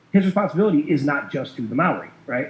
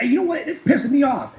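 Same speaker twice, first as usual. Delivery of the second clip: high-pitched mock indignation; going off; 13 semitones higher, 3 dB louder than usual. Banker going off on his tangent at the caller, mock indignant. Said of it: "he was kinda going off".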